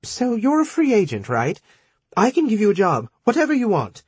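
high, hurried bargaining voice; rushed, high and eager to buy you off; quick pleading woman. Acted. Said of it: "She said quickly".

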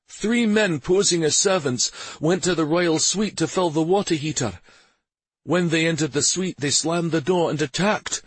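lightly voiced soldier's report; urgent soldier. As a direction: report breathlessly to the commander. Warrior reports the attack.

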